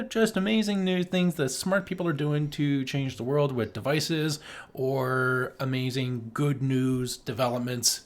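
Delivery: ordinary talk, nothing marked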